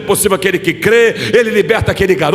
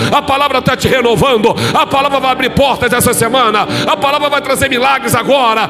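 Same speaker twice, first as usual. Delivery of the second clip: full-force preaching; 8 semitones higher than usual. Acted. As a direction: preach it up to a shouting peak. (pregação inflamada, subindo até o grito)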